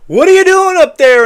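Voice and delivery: mock reporter shout at the president; reporters yelling. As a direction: yell it up at the roof. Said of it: "and reporters said".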